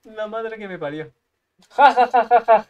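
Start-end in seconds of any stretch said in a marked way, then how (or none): 1.7-2.7 loud laughter. Real, not acted. Streamer enjoying the chaos, loud laughter.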